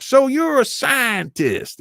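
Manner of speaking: high, loud, drawn-out caricature; slow, high mock interrogation of a witness; mock indignant congressman. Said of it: "this conservative, uh, congressman questioning John Kerry".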